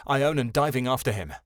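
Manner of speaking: plain reading voice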